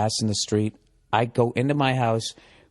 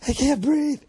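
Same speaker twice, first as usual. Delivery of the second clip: high, strangled panic; panicked gasp; 13 semitones higher, slower than usual. Retold or imitated. Man crushed in a crowded elevator, high panicked gasp. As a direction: gasp it out like you're being crushed.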